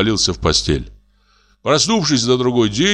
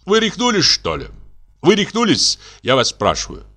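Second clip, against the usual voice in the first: high-pitched indignant questions, each falling away; indignant disbelief; 8 semitones higher than usual. Acted. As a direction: demand it in affronted disbelief. General outraged by marriage proposal.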